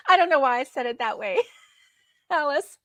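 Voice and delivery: real laughter breaking through the words; laughing. Real, not acted. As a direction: laugh at yourself while talking. Laughing at own fancy slip.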